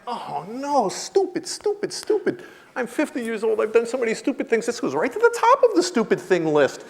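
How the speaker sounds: high-pitched, wincing self-scolding; mock self-reproach. Man berates himself after his blunder, mock anguish. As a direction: wail at your own stupidity.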